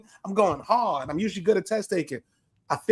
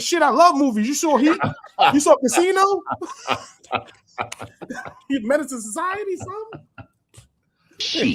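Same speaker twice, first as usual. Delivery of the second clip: excited movie fan; 10 semitones higher, 4 dB louder than usual. Retold or imitated. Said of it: "I was like".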